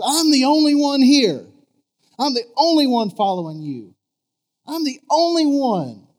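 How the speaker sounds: loud, high whining complaint, the only one left; griping to God. Retold or imitated. Preacher voicing Elijah griping to God, loud high whine. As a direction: wail it like a self-pitying prophet. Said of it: "I love how he gripes to God".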